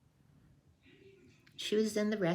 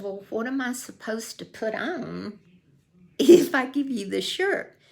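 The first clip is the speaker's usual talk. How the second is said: higher, louder, laughing through it; amused question with a laugh; 4 semitones higher, 7 dB louder than usual. Retold or imitated. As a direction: ask it while half laughing. Asks with a laugh.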